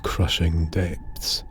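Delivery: plain reading voice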